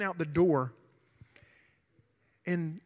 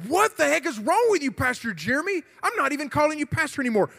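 acted indignation, higher and louder; raised-voice mock outrage; imagined outraged listeners; 8 semitones higher, 6 dB louder, faster than usual. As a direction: scold the pastor as a shocked crowd would. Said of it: "every one of you would be like".

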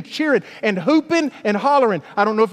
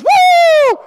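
very high, loud whoop; 10 dB louder than usual. Retold or imitated. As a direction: let out a joyous church whoop. Jimmy whoops after high-five.

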